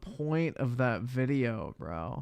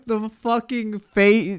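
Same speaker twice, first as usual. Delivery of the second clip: laughing; 8 semitones higher, 5 dB louder, slower than usual. Real, not acted. Laughing at the clip.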